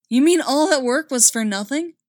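loud, high dismayed question. A narrator's reading. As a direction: blurt it out in dismay. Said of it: "Bridger asked in dismay".

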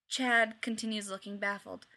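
plain reading voice

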